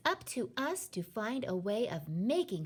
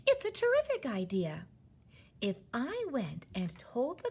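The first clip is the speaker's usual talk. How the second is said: higher-pitched, eager plan; excited; 6 semitones higher, slower than usual. A narrator's reading. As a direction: lay out a brilliant idea, stretching key words. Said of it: "in a voice filled with excitement".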